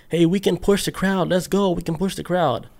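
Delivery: urging the crowd on, lightly acted; mock yell. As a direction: retell a yelled call without really shouting. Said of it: "some dude was just yelling out".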